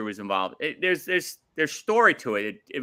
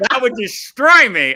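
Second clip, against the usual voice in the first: shouting it out in real dismay; nearly yelling that it would ruin him; loud dismay; 10 semitones higher, 11 dB louder than usual. Real, not acted. Fan shouting to co-host about his favorite losing, loud dismay.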